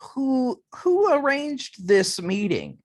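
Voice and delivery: high-pitched, drawn-out challenge; questioning clan member. Acted. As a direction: ask it high and slow. Game master voices questioning clan member.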